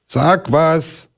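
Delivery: the bear calls out, pleading with the silent pond; calling out. Acted. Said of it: "rief der Brillenbär".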